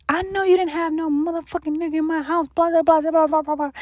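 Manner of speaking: high, fast furious mom rant; imitating livid mom. Daughter imitates her livid mom yelling at her. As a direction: mimic an enraged mother scolding. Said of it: "my mom was fucking livid".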